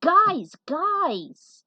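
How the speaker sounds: loud, high call for attention; a friend yelling to break up the group's argument; shouting. A narrator's reading. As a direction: cut through the tension. Calling friends amid rising tension.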